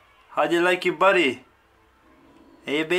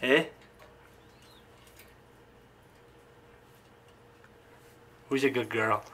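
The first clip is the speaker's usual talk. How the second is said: cooing pet talk; 8 semitones lower, 8 dB quieter than usual. Real, not acted. Cooing praise to pet bird.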